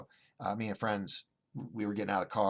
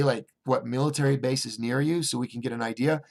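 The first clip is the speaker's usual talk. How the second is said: normal talking voice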